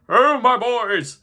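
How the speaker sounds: slow, loud, hearty call; jolly old master. Acted. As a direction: draw it out like a beaming old boss. Fezziwig calls his apprentices.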